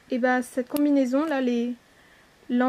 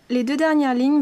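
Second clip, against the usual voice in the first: ordinary talk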